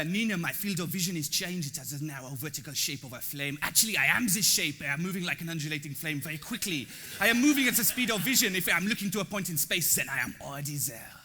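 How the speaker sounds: put-on reading the email in a light French accent; lightly voiced French woman; mock French accent. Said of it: "so I'm just gonna read it off".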